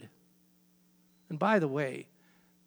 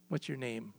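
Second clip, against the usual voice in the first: a softer, lower echo of the question; hushed repeat; 6 semitones lower, 11 dB quieter than usual. Acted. Moses asks God again at the bush, hushed and softer. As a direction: let it drop away, almost to yourself.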